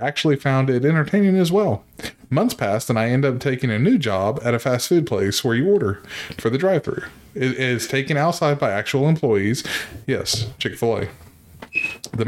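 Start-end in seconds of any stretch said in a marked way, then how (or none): none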